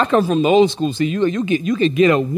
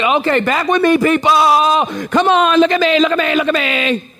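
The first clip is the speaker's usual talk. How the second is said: calling back attention; 5 semitones higher than usual. Retold or imitated. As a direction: pull the room back to you. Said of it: "Then I gotta say".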